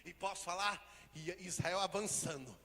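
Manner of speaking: loud, slow, sung-out preaching; preaching at full force. Real, not acted. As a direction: proclaim blessing over the church.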